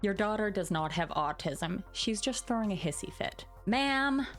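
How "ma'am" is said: ordinary talk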